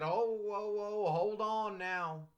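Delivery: a hint of playful alarm; retold self. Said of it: "I said".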